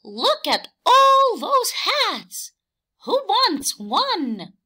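high, slow, loud excited hen; Hen's voice. A narrator's reading. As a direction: call out to the other animals as Hen. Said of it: "asked Hen".

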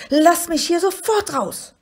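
high, loud furious creature demand; wütender Schrei. A narrator's reading. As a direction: shriek to be let out. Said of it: "schrie ihn das kleine Scheusal an". (hoch und laut wütend geschrien)

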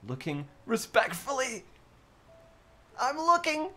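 high-pitched cartoonish put-on voice; squeaky mock voice. Streamer voices chat looking respectfully, squeaky mock voice.

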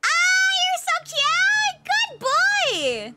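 high, slow doting coo; cooing at a dog. Real, not acted. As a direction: gush over the puppy.

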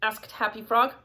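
ordinary delivery

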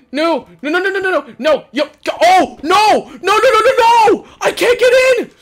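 high, loud frantic no-no-no; panicked shouting. Real, not acted. Locked out while under attack.

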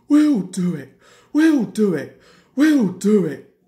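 loud, punched bursts of effort; puffing. A narrator's reading. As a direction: chug it out, hard on the first word. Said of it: "puffed Henry".

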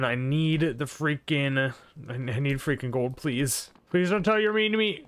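normal talking voice

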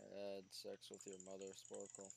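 flat deadpan monotone. Real, not acted. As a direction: say it completely flat. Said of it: "The most monotone voice".

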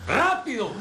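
high, loud impatient command; barked order. Acted. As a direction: shout it as an urgent order. Orden impaciente tras una amenaza. (orden gritada con impaciencia)

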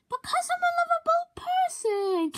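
high, sugary sing-song boast; cutesy sing-song. Acted. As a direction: coo it like a sweet little kid. Cutesy self-praise.

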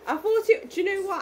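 blurting it out, overwhelmed with joy; excited. Real, not acted. Overwhelmed excited gift reaction.

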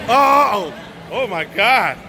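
usual speaking voice

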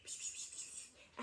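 wordless, hushed pss-wss whispering; secretive whispering. Acted. Little goat to his brothers so the troll won't hear, whispering. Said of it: "He's whispering so the troll doesn't hear".

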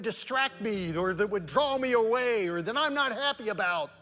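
high, loud sermon climax; preaching at full force. Acted. Pastor urging his church, preaching high and loud. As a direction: build the congregation up, full voice.

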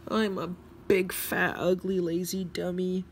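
high-pitched indignant complaint; fuming. A narrator's reading. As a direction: fume it out in a high character voice. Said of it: "fued-, fumed Emily".

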